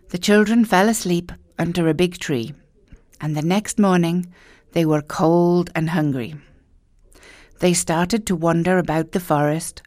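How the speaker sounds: usual speaking voice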